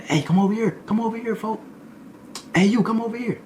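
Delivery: hollering at the new guy to come over; calling out. Retold or imitated. More inmates calling louder.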